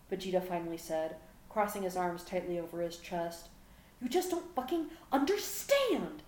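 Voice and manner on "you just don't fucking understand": high, drawn-out furious scream. A narrator's reading. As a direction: build to a long scream on the last word. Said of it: "Gohan screamed".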